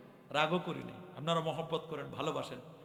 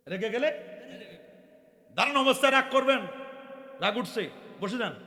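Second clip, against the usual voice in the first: high, loud preacher's cry; fiery preaching; 9 semitones higher, 11 dB louder than usual. Acted. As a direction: hammer the advice home to the crowd. Preaching waz at full force.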